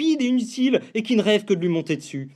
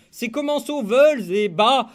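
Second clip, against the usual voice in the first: usual speaking voice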